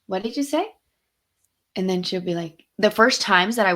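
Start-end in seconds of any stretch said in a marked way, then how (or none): 0.1-0.7 higher, rising questioning lilt; raised-pitch, pointed but kindly question; not mean, prompting. Retold or imitated. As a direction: lightly call someone out without meanness. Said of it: "I won't be mean about it".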